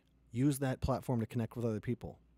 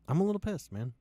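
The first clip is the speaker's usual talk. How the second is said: pitched-up repeat; 7 semitones higher than usual. Real, not acted.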